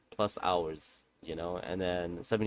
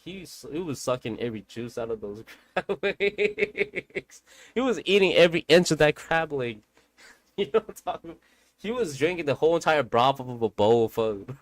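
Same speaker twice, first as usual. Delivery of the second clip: laughing; 4 semitones higher, 7 dB louder than usual. Real, not acted. Laughing about uncle eating.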